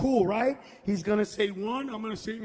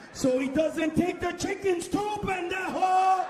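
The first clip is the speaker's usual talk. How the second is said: punchline shout; 6 semitones higher than usual. Real, not acted. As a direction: bark it at the crowd. Punchline yelled.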